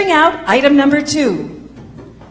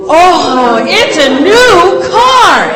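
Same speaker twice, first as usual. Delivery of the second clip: booming prize announcer; 2 semitones higher, 5 dB louder, much slower than usual. Acted. Host unveils the car prize.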